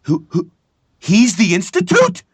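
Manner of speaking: high, loud shocked outburst; stunned disbelief. Retold or imitated. Actor re-living discovering the plot twist, shocked high cry. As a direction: voice jumps up in sudden shock. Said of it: "recreation and reperformance of it".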